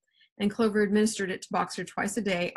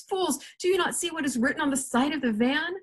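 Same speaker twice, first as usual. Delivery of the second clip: high, exasperated cry; high-pitched outcry at moderate volume; shouted; 7 semitones higher than usual. A narrator's reading. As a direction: scold them urgently with raised pitch. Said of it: "shouted Benjamin".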